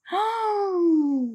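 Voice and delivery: high, soft gasp; sharp high intake of shock; appalled gasp. Real, not acted. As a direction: gasp in disbelief. Appalled at weight tracking.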